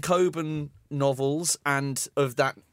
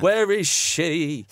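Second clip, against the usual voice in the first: slow, louder, hammed-up theatrical plea; 2 semitones higher, 5 dB louder, much slower than usual. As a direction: overact the desperate father, slowly. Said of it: "he doesn't go through the gated community going".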